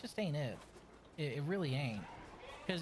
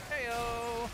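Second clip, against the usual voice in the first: high cheeky punchline shout; comedic heyo shout; 10 semitones higher, 4 dB louder than usual. Acted. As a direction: sell the joke with a loud heyo. Punchline after joke on Conclave.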